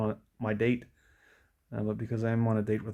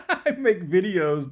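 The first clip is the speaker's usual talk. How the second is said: laughing; 8 semitones higher, 7 dB louder, slower than usual. Real, not acted. Bursts out laughing at himself.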